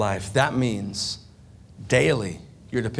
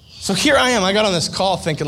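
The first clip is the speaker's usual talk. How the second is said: exasperated outburst; 7 semitones higher, 6 dB louder, faster than usual. Real, not acted. Ironic climax of the confession.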